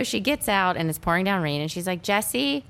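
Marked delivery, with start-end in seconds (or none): 2.0-2.6 put-on high, hesitant little girl call; girl calling the tow truck man, high and unsure; timid call. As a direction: call him like a shy kid.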